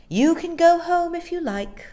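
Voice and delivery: high, loud haughty nanny voice. Acted. Nanny dismissing the cross boy haughtily. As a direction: look down your nose at the boy. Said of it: "she said haughtily".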